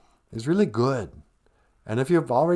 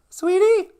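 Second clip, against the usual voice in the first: much higher, louder sweet call; partner voice; 6 dB louder than usual. Pretend. Host playing his co-host calling him, high and sweet.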